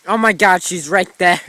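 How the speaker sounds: ordinary talk, nothing marked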